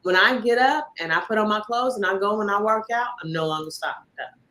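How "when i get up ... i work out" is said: ordinary delivery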